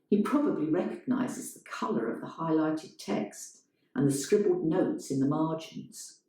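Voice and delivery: ordinary delivery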